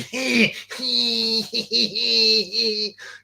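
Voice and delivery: long squealed hee-hee-hee laugh; put-on high snickering; mock high giggle. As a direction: imitate a high, drawn-out giggle. Mimics the caller's giggle.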